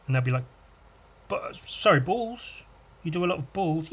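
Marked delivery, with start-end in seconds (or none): 1.3-3.9 mock disbelief. Stranger questioning in disbelief.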